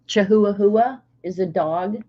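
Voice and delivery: ordinary talk, nothing marked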